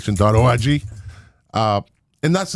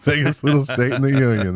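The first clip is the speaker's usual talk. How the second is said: chuckling while echoing the phrase; laughing; 4 semitones higher than usual. Real, not acted. Host echoes the guest's state slogan, laughing. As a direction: laugh as you repeat it back.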